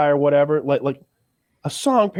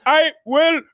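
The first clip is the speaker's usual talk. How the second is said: much higher, mock accented scammer reply; mock Indian accent; 12 semitones higher, 3 dB louder than usual. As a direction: scam caller snapping back before hanging up, mock accent. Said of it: "And he's like".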